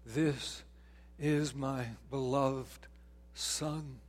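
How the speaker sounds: slow, solemn voice of God; slow and hushed, like God speaking. Retold or imitated. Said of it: "heard the voice of God say".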